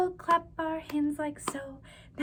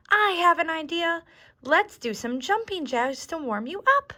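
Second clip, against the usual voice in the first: put-on louder, bright little-girl excitement; eager idea; 4 semitones higher, 9 dB louder than usual. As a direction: burst out with the idea.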